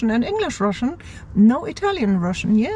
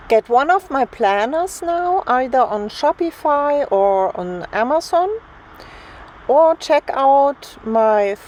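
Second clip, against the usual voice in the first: upbeat ad read; 5 semitones higher than usual. Acted. Streamer plugging her planners and stickers to viewers, upbeat ad read. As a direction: pitch the planners like an announcer. Reads out a promo for her shop.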